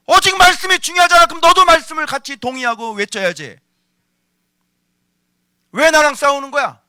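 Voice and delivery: 힘주어 크게 몰아붙이는 설교; 위선을 꾸짖듯 거듭 따져 묻기. Acted. 격하게 따져 묻는 설교. (호통치는 설교조)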